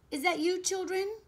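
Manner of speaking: high, loud searching question; concerned call. Acted. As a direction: call out to crying children. Calls toward crying children.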